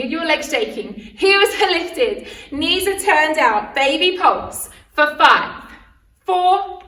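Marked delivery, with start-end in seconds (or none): none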